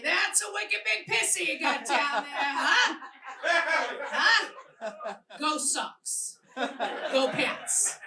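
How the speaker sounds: put-on lightly done Boston accent, a touch brassy; hint of a wicked Boston drawl. Woman teasing her lover in a light Boston accent. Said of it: "and I say".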